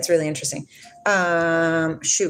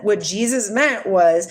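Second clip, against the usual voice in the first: ordinary talk, nothing marked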